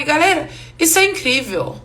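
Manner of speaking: usual speaking voice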